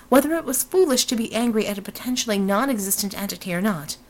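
plain reading voice

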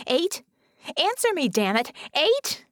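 high, loud, angry calling; exasperated, high-pitched shout; frantic demand. A narrator's reading. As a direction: yell for a reply. Bella yells for an answer.

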